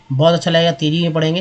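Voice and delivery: ordinary talk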